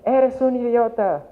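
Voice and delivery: slow, high, loud insult, acted out; retold road rage yell. Father in the car yelling at the driver who cut him off, slow high angry shout. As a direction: bark the insult like a furious driver. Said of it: "el señor agarraba y le gritaba".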